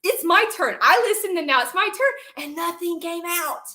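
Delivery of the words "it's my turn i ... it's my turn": high, loud demand to come back; imitated angry outburst. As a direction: act out the furious retort you meant to say. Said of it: "I'm gonna be like".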